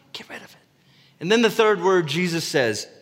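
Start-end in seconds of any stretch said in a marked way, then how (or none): 0.1-0.6 whispered command. Acted. The Lord's word to the listener, whispered. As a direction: hush it out as an urgent secret.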